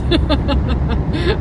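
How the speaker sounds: short burst of high laughter; quick high giggle; laughing. Real, not acted. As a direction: crack up right after insisting. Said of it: "I'm laughing".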